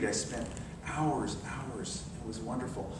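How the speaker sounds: fading fond memory. Real, not acted. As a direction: savour the memory quietly.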